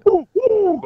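high owl hoots. Shown as a demonstration. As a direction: hoot like an owl. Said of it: "that's my owl".

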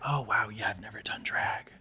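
hushed whisper; whispered aside. Retold or imitated. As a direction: whisper it like a secret confession. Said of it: "And I'm like".

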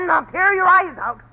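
loud, high, spiteful warning; furious threat. Acted. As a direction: snarl it at him. Wife threatening husband.

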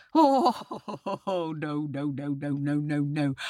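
hammed-up chuckling denial; wheedling tiger. A narrator's reading. Tiger reassures the doubting boy, mock-laughing denial.

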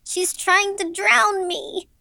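put-on very high, drawn-out little-girl cry; slow, high panicked wail; child's scream. A narrator's reading. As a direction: wail it as a terrified six-year-old. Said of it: "she screamed".

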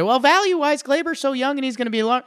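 high, fast mocking voice of a critic; mock objector. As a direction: squeak out the rebuttal in a mocking high voice. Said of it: "people could come at me right now and say".

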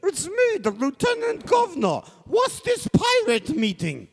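very high, drawn-out British official; squeaky mock British Governor; high-pitched Governor. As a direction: announce yourself in a silly high British voice. Plays the Lieutenant Governor in sketch.

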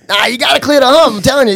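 high-pitched mock insistence; arguing park guy. Guy at the jump insists to his buddy, arguing. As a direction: imitate a bro digging in on his point. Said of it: "these guys are arguing".

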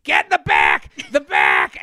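low mock shout; crowd shouting. Second bagel line shouting at him to go back. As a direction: bellow it like a pushy crowd. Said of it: "they're going".

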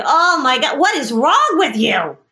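a hint of an exasperated sigh at her; husband fed up with his wife's bat worries; mock exasperated husband. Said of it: "he would say".

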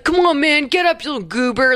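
playful retold nagging; older brother. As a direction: imitate a big brother rousing a sibling. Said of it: "The older brother's like".

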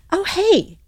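high, airy imitation of nonchalance; put-on breezy husband; mock casual. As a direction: toss it off high, as if just noticing. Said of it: "He's so casual".

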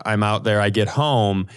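ordinary talk, nothing marked

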